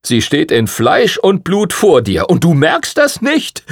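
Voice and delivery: high-pitched, climbing disbelief; astonished teasing, pitch rising to the end; amused incredulity. A narrator's reading. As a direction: marvel at how blind he is. Prince teases the oblivious lad.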